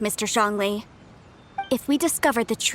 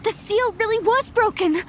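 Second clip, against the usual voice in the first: surprised exclaim; 9 semitones higher than usual. Acted. Seal found broken, exclaiming.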